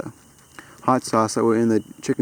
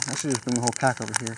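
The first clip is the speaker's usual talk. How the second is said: normal talking voice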